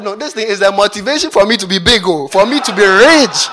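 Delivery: loud, rising preacher declaration; mock declaration. Preacher mock-declaring his future riches to the crowd, loudly. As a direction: proclaim it like a bold faith confession.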